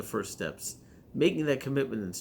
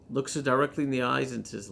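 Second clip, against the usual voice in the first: ordinary talk, nothing marked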